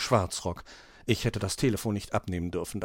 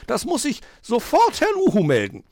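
high, loud indignant threat; outraged scolding; 11 semitones higher, 5 dB louder than usual. A narrator's reading. Magpie threatens to report the children, outraged. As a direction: fume like an offended magpie. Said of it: "schimpfte die Elster außer sich".

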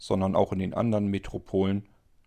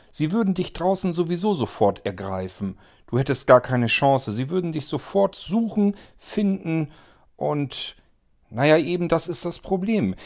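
somewhat angry; 7 semitones higher, 4 dB louder than usual. Acted. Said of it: "sagte Franka nun etwas böse".

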